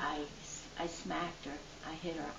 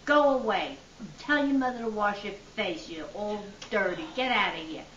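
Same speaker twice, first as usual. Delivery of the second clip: retold holler; 2 semitones higher, 7 dB louder than usual. Older woman yelling at the little girl, loud and scolding. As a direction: shoo the kid off loudly, pitch up. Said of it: "she would holler at me".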